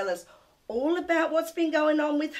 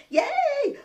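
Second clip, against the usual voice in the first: high, excited whoop; playful cheer; 12 semitones higher, 3 dB louder than usual. Real, not acted. Host delighted about help with dishes, excited cheer. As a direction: cheer it out on a soaring pitch.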